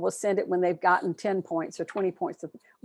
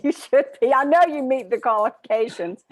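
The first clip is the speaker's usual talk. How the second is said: higher and louder, laughing through the words; laughing tease; 6 semitones higher, 6 dB louder than usual. Real, not acted. Host teasing Ernie to apply for his pin: laughing, louder. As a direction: laugh while nudging him to apply.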